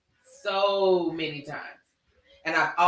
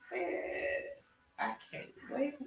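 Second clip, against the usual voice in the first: whispered excitement; 8 semitones higher, 14 dB quieter, slower than usual. Real, not acted. Excited about trip.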